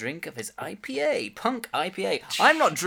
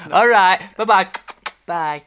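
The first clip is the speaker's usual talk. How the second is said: lightly voiced elderly guest, with a loud high goodbye; a hint of Alan Bennett signing off; 6 semitones higher, 10 dB louder, slower than usual. Guest saying goodbye to the host, lightly voiced. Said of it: "as Alan leaves".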